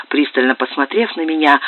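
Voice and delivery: ordinary delivery, nothing marked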